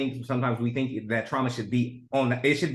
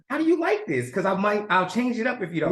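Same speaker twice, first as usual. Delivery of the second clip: much higher mom voice, eager to please; imitated accommodating mom; 9 semitones higher than usual. Mom asking her child about the meal, imitated high obliging voice. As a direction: imitate a mother checking sweetly, pitched way up. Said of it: "my mom would be like".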